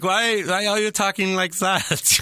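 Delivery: put-on baffled question in Finnish-accented English. Finnish client asking the actors, puzzled Finnish accent. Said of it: "And, and he's like".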